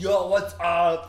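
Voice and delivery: loud, drawn-out mock Austrian greeting; Arnold Schwarzenegger. Cartoon neighbor greeting the family, booming Austrian accent. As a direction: holler it slowly with an Austrian accent.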